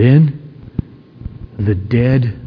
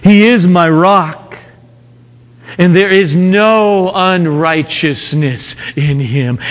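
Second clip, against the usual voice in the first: high, strong proclamation; preaching; 4 semitones higher, 3 dB louder than usual. Acted. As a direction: declare it to the congregation. Final declaration of the verse.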